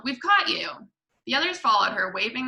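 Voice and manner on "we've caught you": high, mock-fierce yell. A narrator's reading. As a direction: challenge the intruder, pitched up. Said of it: "she yelled".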